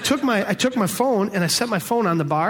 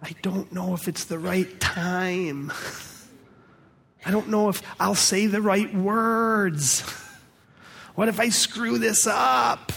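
slow, worried, rising higher toward the end; hesitant fretting that climbs into a near-shout; anxious self-doubt; 4 semitones higher, slower than usual. Retold or imitated. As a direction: voice the nervous believer talking himself out of it. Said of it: "we do things like this".

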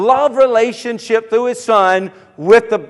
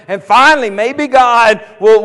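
ordinary talk

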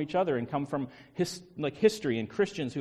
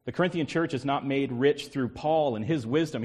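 usual speaking voice

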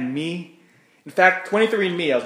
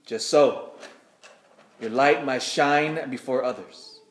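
scripture reading. Acted. Reads Gospel aloud at Mass.

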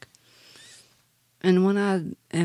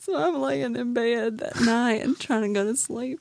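normal talking voice

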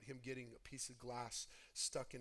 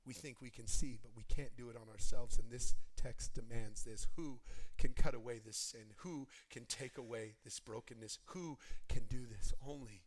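loud, insistent sermon challenge; full-force preaching; 2 semitones lower, 11 dB louder than usual. Acted. Pastor challenging the congregation about sin, loud preaching. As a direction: press the church with each question.

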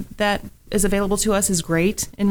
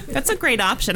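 laughing; 8 semitones higher than usual. Real, not acted. Laughing at the drone joke.

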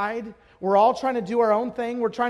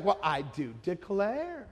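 slow, soft, low put-on lady voice; Southern lady impression; 4 semitones lower, 7 dB quieter, slower than usual. Lady rolling her eyes at the preacher, mock-affronted drawl.

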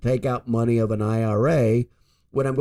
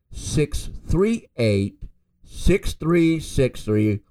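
slow dictation; 2 semitones higher, much slower than usual. Real, not acted. Repeating phone number.

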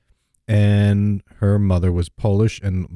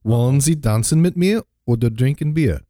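slightly higher put-on German question; German-accented invitation, pitched up; 4 semitones higher than usual. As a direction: press the question in playful German. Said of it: "German accent is great".

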